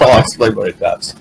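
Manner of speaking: normal talking voice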